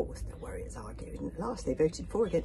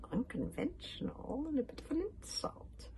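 soft, high witchy purr; purring witch; 10 semitones higher, 6 dB quieter than usual. A narrator's reading. Witch answering the warriors at her door, purring. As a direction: purr it with quiet amusement. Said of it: "purred the witch".

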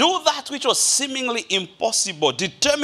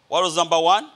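usual speaking voice

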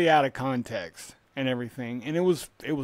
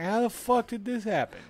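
higher-pitched, mock-bewildered question; retold bewilderment; 7 semitones higher, 3 dB quieter than usual. Comedian baffled by protests at his shows, retold bewilderment. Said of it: "he's like".

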